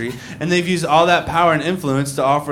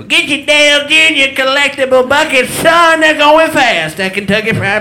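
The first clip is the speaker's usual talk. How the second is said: hammed-up ad announcer; 10 semitones higher, 5 dB louder than usual. Mock pitchman hawking collectible buckets, loud and high. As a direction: belt it like a cheesy fried chicken ad. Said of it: "that awful commercial".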